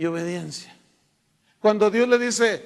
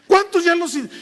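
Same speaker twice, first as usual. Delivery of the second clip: preacher calling out; 9 semitones higher, 7 dB louder than usual. Retold or imitated.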